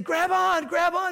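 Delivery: loud, high urgent call; shouting from helicopter. Acted. Helicopter crew yelling to the stranded man, loud and urgent. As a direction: yell it down the rope to him.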